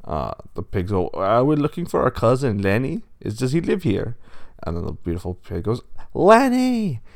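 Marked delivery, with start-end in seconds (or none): 1.1-4.1 lightly voiced pig, higher and louder; inquiring pig. A narrator's reading. Pigs at the door asking for cousin Lenny, inquiring. 6.1-7.0 very high, drawn-out call; yelling upstairs. A narrator's reading. Pig calls her cousin down the stairs, yelling. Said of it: "She yells up the stairs".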